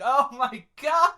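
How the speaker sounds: high, loud, drawn-out exclamation; hammed-up disbelief; retold shock. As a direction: cry it out slowly in mock horror. Retold shocked reaction to friend's confession.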